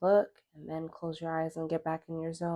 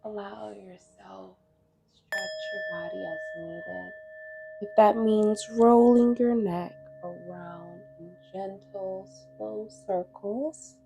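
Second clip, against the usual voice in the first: guided meditation; 4 semitones higher than usual. Acted. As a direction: guide them gently through breath and stretch, unhurried.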